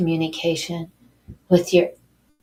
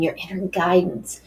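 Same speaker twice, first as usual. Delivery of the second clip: usual speaking voice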